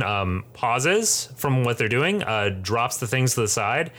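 usual speaking voice

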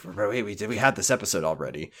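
low, quiet deadpan aside; deadpan mutter. Retold or imitated. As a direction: mumble it dryly. Steven deadpan.

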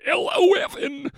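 high put-on goofy voice; silly character voice. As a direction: pitch it up as a made-up brother. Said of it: "How long do you think I could keep that voice up".

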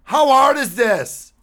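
high, slow exasperated question; slow exasperated disbelief. Real, not acted. Exasperated rant about gym etiquette.

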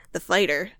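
normal talking voice

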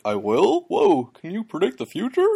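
high-pitched, amazed and clueless voice; Gamzee. Acted. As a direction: marvel at it in a high, spaced-out voice. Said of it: "Which is how he talks".

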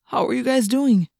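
ordinary delivery, nothing marked

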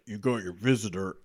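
low-pitched put-on guard voice; crazy prison guard. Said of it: "the crazy prison guard's like".

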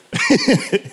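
real burst of laughter. Real, not acted. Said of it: "it cracked me up".

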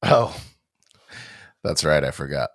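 normal talking voice

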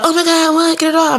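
high, fussed squeal of alarm; mock panic. Middle schooler told something is on their face, mock panic. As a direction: play the fooled victim, high and urgent.

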